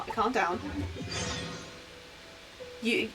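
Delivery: ordinary delivery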